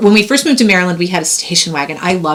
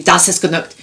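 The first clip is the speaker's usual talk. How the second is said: German phrase. Retold or imitated. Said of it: "instead of saying".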